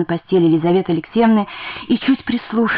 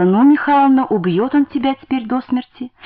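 higher-pitched pitying lament; 6 semitones higher than usual. A narrator's reading. Landlady fearing for the battered wife, pitying. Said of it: "жалостливо сказала".

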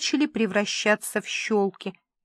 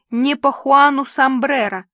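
louder, higher heckle; ringing out; 4 semitones higher, 7 dB louder than usual. A narrator's reading. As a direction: call it out from the crowd. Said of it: "прозвенело из группки людей". (звонко, громко)

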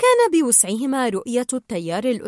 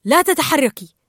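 loud, raised, commanding; firm order; 4 semitones higher, 7 dB louder than usual. A narrator's reading. Marlin ordering Dory to freeze among jellyfish, firmly. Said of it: "فأمر دوري".

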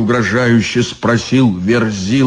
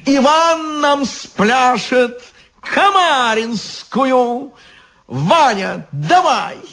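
whiny demand; 12 semitones higher, slower than usual. Acted. Devil demanding dance.

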